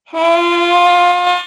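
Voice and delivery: high, loud bright hey; shouted greeting; loud call. Shown as a demonstration. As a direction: call out to the room. Vocal warmup opener call.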